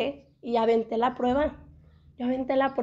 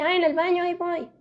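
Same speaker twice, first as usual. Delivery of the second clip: calling back; 6 semitones higher, 4 dB louder than usual. Retold or imitated. Answers husband from bathroom.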